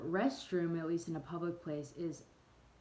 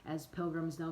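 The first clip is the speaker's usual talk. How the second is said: ordinary talk, nothing marked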